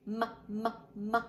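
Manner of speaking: "M" hummed as a held mmm sound, not the letter name. Shown as a demonstration. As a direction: hum "M" as mmm for the kids. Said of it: "The letter M".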